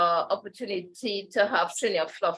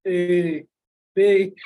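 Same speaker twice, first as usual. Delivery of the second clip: deep voice alphabet; 3 semitones lower, 6 dB louder than usual. Retold or imitated. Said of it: "Morgan Freeman could say the alphabet".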